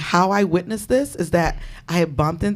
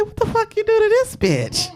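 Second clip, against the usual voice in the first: incredulous laughing question; 12 semitones higher than usual. Retold or imitated. Asks friend laughing.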